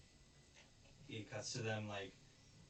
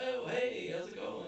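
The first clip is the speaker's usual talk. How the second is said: much higher, louder, breathy cheery hello; cheery greeting; 12 semitones higher, 5 dB louder than usual. Retold or imitated. Onizuka popping out to greet the officer brightly. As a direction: pop in with a bright fake hello. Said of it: "He's like".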